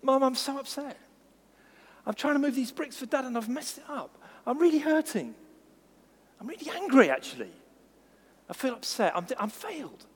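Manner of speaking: put-on a small boy giving up, close to crying; upset little boy. Preacher voicing a hurt small boy who gives up.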